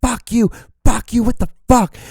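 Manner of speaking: high-pitched imitation of a furious driver; mock angry yelling. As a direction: mimic road-rage yelling, pitched high but not truly shouted. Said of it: "like, yelling at me".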